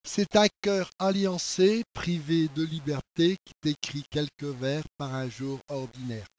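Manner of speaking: slow, soft poetry reading, sinking low; poem recitation. Acted. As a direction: recite tenderly, letting each line drop. Reads the poem aloud. (récitation de poème, lente et douce)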